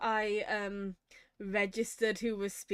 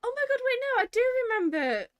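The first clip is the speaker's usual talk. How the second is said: sudden realization; 11 semitones higher, 4 dB louder, faster than usual. Real, not acted. Suddenly recalls the trailer.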